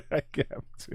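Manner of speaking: whispered through laughter; barely gets it out, laughing; breathless laugh. Real, not acted. Cracking up at the clip.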